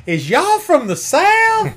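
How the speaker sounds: put-on very high, loud, slow Southern accent; hammed-up Southern whoop, vowels stretched long; cartoon Southern drawl. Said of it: "I love that Bugs Bunny cartoon".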